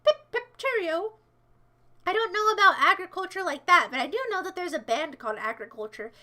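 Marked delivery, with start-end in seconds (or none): 0.0-1.1 high, hammed-up English accent; posh British parody voice; mock English accent. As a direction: play a cartoon English toff, higher. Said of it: "If I was English, I'd be like".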